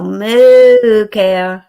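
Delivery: put-on loud, drawn-out "Moo cow" for a baby; sing-song moo cow. Grandma calling to the baby, loud sing-song moo cow. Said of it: "And I'll go".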